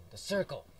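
"circle" punched high and loud; emphatic exclamation. Real, not acted. Repeats words with sudden emphasis.

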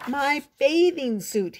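high, mildly shouted wail; shouting. A narrator's reading. Froggy in the pond to his mother, shouting. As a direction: cry it out like an embarrassed child. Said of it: "he shouted".

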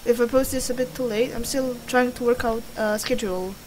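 normal talking voice

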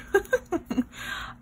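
laugh. Real, not acted. Amused at tiny cutting.

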